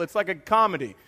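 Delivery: ordinary delivery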